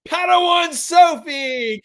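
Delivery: slow, high, loud announcement of the name; announcing winner. Real, not acted. Streamer announcing the giveaway winner to chat, slow and loud. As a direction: call out the winner's name like a herald.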